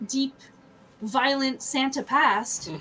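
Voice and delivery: ordinary delivery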